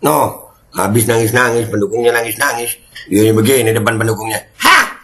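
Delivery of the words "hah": sudden high startled squeak; shocked hah. Real, not acted. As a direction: react in loud disbelief. (kaget melengking)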